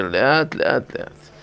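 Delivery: hushed, almost whispered aside; slowly, gently. Shown as a demonstration. Rabbi reminding himself to speak slowly, hushed. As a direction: drop right down and ease it out softly. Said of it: "לדבר לאט".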